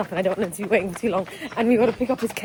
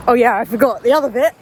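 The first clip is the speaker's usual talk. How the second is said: ordinary delivery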